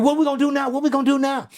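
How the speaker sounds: high, anxious pleading in a put-on voice; a frightened dad begging for answers; panicked father. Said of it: "He said".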